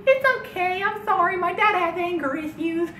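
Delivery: put-on very high, squeaky little-kid apology; twelve-year-old boy. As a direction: apologise for dad in a tiny child's voice. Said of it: "I'm like this twelve-year-old boy".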